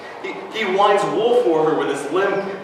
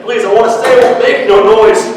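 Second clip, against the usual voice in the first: pleading; 4 semitones higher, 7 dB louder, faster than usual. Acted. Child begging his mother.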